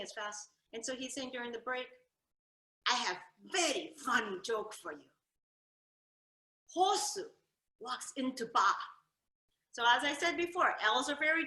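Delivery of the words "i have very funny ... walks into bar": Jinglish. Retold or imitated. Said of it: "Japanese and English and kinda mushed together".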